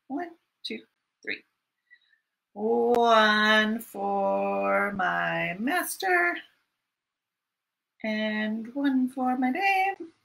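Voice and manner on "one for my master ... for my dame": very slow sing-song line; slow recitation. Acted. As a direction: draw each word out.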